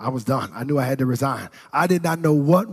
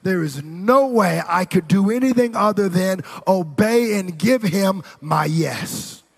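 raised-pitch sermon delivery, building in force; impassioned preaching; 4 semitones higher, 4 dB louder than usual. Real, not acted. Preacher urging congregation to obey and give, impassioned preaching. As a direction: press it on the congregation, climbing toward the end.

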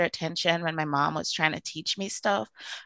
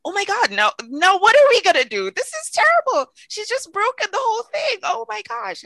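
high, loud, frantic panic, hammed up for the story; panicking; 13 semitones higher, 5 dB louder than usual. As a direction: retell the moment the pants ripped, squealing in mock alarm. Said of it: "I'm panicking".

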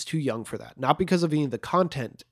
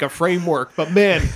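usual speaking voice

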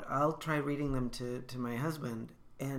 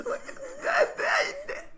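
about-to-cry squeal. Shown as a demonstration. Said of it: "it goes up about eight octaves".

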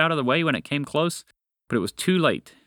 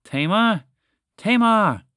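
high, loud cries building higher; growing panic; 8 semitones higher, 6 dB louder, slower than usual. A narrator's reading. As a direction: call for a lost sister, louder each time. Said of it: "her voice grew louder and filled with more and more panic".

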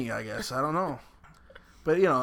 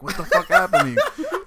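laughing quote; 7 dB louder than usual. Retold or imitated. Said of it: "He's like".

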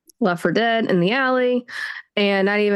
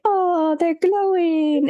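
higher and slow, drawn-out knowing oh; knowing mock wonder; 8 semitones higher, much slower than usual. Reader reacting to the wedding night line, slow and knowing. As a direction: tease it with slow, wide-eyed innocence. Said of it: "and you're just like".

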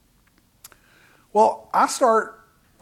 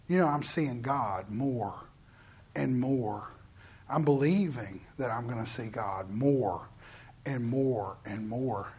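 much softer, lower and slower; soft reflective preaching; 8 semitones lower, 10 dB quieter, slower than usual. Acted. Preacher to congregation, soft and slow reflection. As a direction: draw the listeners in close.

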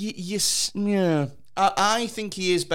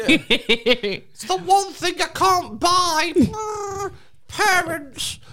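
high, slow whiny mockery; mock whiny Bruce Wayne; much slower than usual. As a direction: whine it like a spoilt rich boy.

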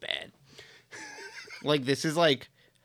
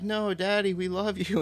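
put-on higher-pitched pleading child; imitated kid; 7 semitones higher than usual. Imitating a kid begging a dad to stay, higher child voice. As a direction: plead lightly as a small kid. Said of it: "And the kid's like".

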